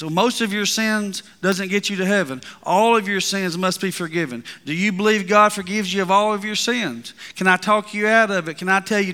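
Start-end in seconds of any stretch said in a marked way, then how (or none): none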